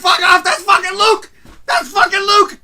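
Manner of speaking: high, loud ecstatic yelling; losing it. Retold or imitated. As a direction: shriek the discovery like a thrilled fan. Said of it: "I lost my shit".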